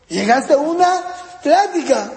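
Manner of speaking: normal talking voice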